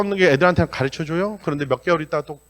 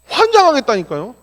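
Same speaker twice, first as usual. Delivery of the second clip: exasperated; 9 semitones higher, 6 dB louder than usual. Real, not acted. Exasperated about ungrateful kids.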